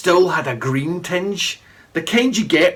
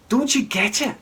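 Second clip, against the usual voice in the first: put-on urgent boy; 8 semitones higher than usual. A narrator's reading. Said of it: "I said".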